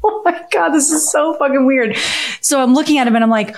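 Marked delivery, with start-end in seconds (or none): 0.0-2.0 higher-pitched, acted-out bewilderment; mock alarm, pitched up; retold disbelief. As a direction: replay your freaked-out reaction from the dream. Acts out own dream panic to Dave.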